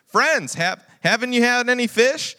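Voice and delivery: loud, high shout across water; calling out. Acted. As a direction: shout the question to the boat. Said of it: "He called out to him".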